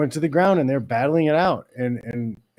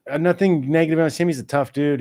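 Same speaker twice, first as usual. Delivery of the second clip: usual speaking voice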